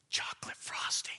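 breathy unvoiced hush for the punchline; whispered reveal. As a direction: whisper it like a secret.

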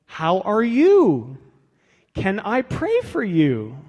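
hammed-up solicitous check-in; exaggerated pastoral concern. Shown as a demonstration. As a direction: draw it out like an over-careful pastor. Models gentle leading questions, hammed up.

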